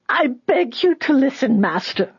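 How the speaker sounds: put-on high-pitched, pleading old woman; desperate screech. A narrator's reading. Old woman begging Judah on her knees, desperate screech. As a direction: cry out high, begging desperately, dropping on the last word. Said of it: "cried out in a desperate screech".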